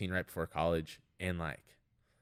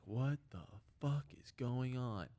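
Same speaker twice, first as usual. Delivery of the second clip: slow, higher-pitched mock yell, kept soft; retold angry dad; 4 semitones higher, 8 dB quieter, slower than usual. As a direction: imitate an exasperated dad without shouting, dragging the words out. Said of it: "yelling at me".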